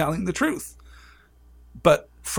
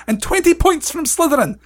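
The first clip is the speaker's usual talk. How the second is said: high, loud, indignant scolding; acted outraged teacher's tirade; shouted Professor McGonagall; 9 semitones higher, 6 dB louder than usual. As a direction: tear into a pupil caught out of bed. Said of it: "she shouted".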